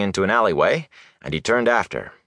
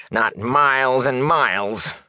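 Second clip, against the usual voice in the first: higher, drawn-out distaste; spat out; 6 semitones higher, slower than usual. A narrator's reading. Peddler refusing to go near the Aes Sedai, spat out. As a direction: stretch the words with loathing. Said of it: "He spat the last words".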